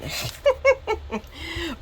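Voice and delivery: high giggle; laughing. Real, not acted. Crafter joking her hoard is a collection, giggling. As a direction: giggle at your own excuse.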